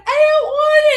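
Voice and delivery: put-on high, loud whiny child voice; whiny kid refusing. Mom voicing her picky daughter, high whiny refusal.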